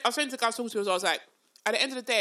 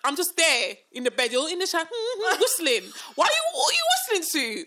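mock outraged; 6 semitones higher than usual. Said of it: "I just thought".

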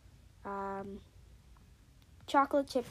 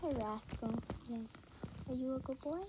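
talking to a dog; 11 dB quieter than usual. Real, not acted.

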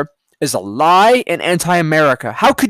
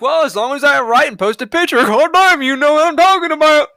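higher, louder mock voice breaking into a laugh; mocking lazy journalist; 9 semitones higher, 4 dB louder than usual. Mocking journalists who post pictures without names, higher laughing mock voice. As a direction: lampoon a careless journalist with a sneer. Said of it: "They just think".

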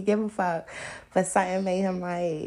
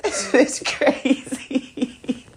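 put-on laughing boyfriend; 7 semitones higher, 4 dB louder, much slower than usual.

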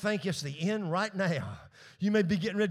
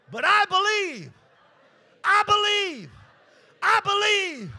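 each I believe higher and louder; rising shout; 13 semitones higher, 4 dB louder, slower than usual. Real, not acted. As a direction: climb to a ringing cry. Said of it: "congregation call-and-response crescendo".

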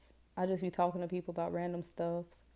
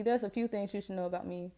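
usual speaking voice

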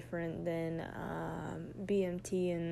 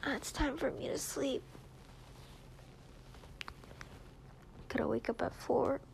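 pillow talk; 2 semitones higher, slower than usual. Real, not acted. Bedtime goodnight to partner.

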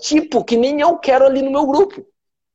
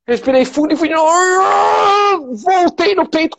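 high, slow strained push, near crying; straining near tears; 7 semitones higher, slower than usual. Retold or imitated. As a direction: relive the last painful rep. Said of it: "quase chorando". (esforço, quase chorando)

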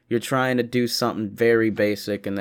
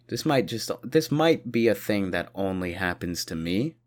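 usual speaking voice

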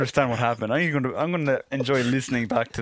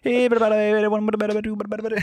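higher, louder nonsense patter; gabbled intro; 9 semitones higher, 5 dB louder, faster than usual. Shown as a demonstration. Cohost mimicking fast gibberish intro. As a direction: rattle it off at top speed. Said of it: "as quickly as possible".

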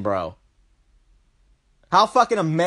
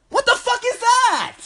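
incredulous outburst. Real, not acted. Outraged at celebrity presidents.

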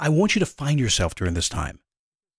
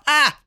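short high burst of a scream, much louder; mock scream; 13 semitones higher, 6 dB louder than usual. Shown as a demonstration. Showing listeners the first freak-out scream. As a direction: let out a sudden panicked scream. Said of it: "freak out, scream, yell, run".

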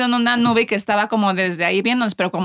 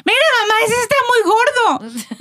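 little son blurting out to his mother in public; mother voicing her kid's tactless remark, pitched high; 13 semitones higher than usual. Kids shout in public.

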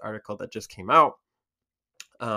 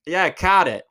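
louder, higher New York accent; strong New York accent; 7 semitones higher, 9 dB louder, slower than usual. Shown as a demonstration. Creator demonstrating the word caught, strong New York accent. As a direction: punch it out like a strong New Yorker. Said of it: "that's a very strong".

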